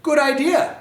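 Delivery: high, loud cheery voice; parents agreeing. Acted. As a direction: chirp it brightly together. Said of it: "looked at each other and said".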